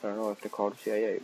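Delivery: ordinary delivery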